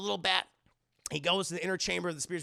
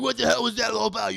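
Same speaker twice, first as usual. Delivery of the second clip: loud, mildly mock-angry challenge; angry confrontation; 3 semitones higher, 7 dB louder, faster than usual. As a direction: imitate a teammate starting a quarrel. Retold quarrel between teammates.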